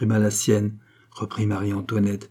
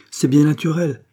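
higher, louder dry retort; curt, dry; 6 semitones higher, 5 dB louder than usual. A narrator's reading. Marie-Antoinette answers stunned Charny, curtly. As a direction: cut in drily, with emphasis. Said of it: "reprit sèchement Marie-Antoinette".

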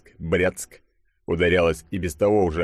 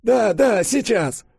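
high, louder hurried-sounding assent; flustered professor; 5 dB louder, much slower than usual. Acted. Professor asking the chief for a moment, high and flustered.

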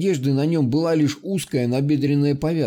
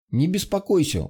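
ordinary delivery

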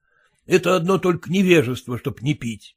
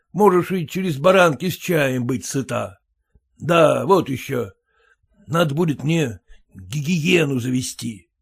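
ordinary delivery

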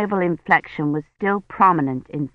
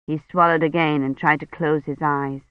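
plain reading voice

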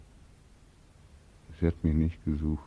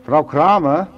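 higher and louder, a sharp call; 9 semitones higher, 11 dB louder than usual. Acted. Beckmann summons Frau Kramer, calling out high and loud. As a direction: call her as if across a street.

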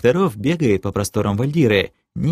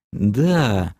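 ordinary delivery

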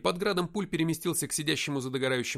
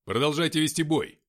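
loud, raised military order; command; 4 semitones higher, 8 dB louder than usual. A narrator's reading. Captain ordering his men to keep fighting, commanding. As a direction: give a firm loud order. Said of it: "скомандовал я".

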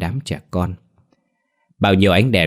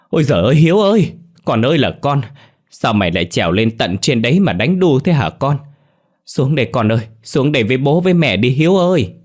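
much higher, louder, fast desperate cry; frantic wail; 6 semitones higher, 4 dB louder than usual. A narrator's reading. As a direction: parents wailing for their child up the bamboo. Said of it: "hoảng hốt kêu lên". (bố mẹ gào khóc hoảng hốt gọi con)

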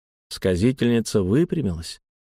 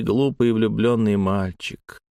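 plain reading voice

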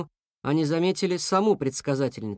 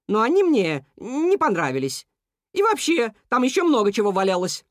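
high, louder cuckoo protesting; defiant cuckoo; 6 semitones higher, 4 dB louder than usual. A narrator's reading. Cuckoo argues with moose and dog, high and loud. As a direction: cuckoo defends herself hotly.

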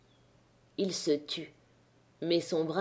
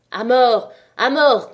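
cris bien plus forts, qui montent; foule menaçante; 4 semitones higher, 8 dB louder, slower than usual. A narrator's reading. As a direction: grondez la menace de mort. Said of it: "commençait à gronder". (la foule menace Chaval, cris forts)

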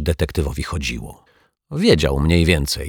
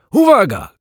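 high, loud warning shout; 7 dB louder than usual. A narrator's reading. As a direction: bellow it much higher and louder. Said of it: "krzyczał".

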